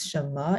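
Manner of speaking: "什么" lifting at the end as a question; rising question tone. Shown as a demonstration. Teacher giving a Chinese example, "什么" with questioning rise. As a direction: ask "什么" with a rising tone. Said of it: "you always ask with the rising tone".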